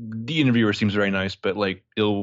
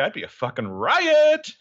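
sarcastic exclamation; 12 semitones higher, 3 dB louder, slower than usual. Pretend. Sarcastic joke about cemetery.

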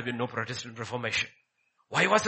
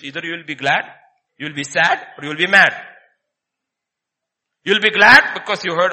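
each word louder, glad, sad, MAD; building preacher's triad; 11 dB louder than usual. Acted. Preacher naming three reactions, building louder. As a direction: climb the three words to a shout.

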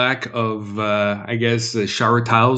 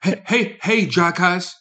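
put-on higher-pitched, unconvincing British accent; amateur British heckle, pitched up; bad British accent; 6 semitones higher, slower than usual. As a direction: have another go at a shaky British accent. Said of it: "it doesn't work for me".

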